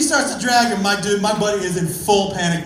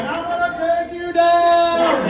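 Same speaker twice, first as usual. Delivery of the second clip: high, slow, drawn-out angry shout; hammed-up furious roar; mad battle cry; 8 semitones higher, 4 dB louder, much slower than usual. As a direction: yell it while charging at her. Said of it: "He was mad".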